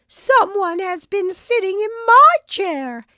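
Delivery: high, loud howl of a mother bear. A narrator's reading. Mother Bear howls about her chair. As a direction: let it rise into a loud howl. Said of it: "howled Mother Bear".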